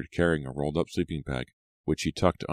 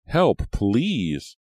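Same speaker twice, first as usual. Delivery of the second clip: high, loud plea; cry for help; 7 semitones higher, 5 dB louder than usual. A narrator's reading. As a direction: call out urgently for help. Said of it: "a scream for help".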